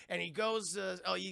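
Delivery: usual speaking voice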